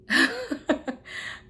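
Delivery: a short high laugh at a sweet memory. Real, not acted. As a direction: laugh fondly, half to yourself. Laughs recalling son's card.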